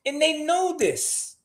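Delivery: high, slow Chris Tucker drawl; Chris Tucker impression. Host mimics Chris Tucker about his kids, high and slow. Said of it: "Sound like Chris Tucker".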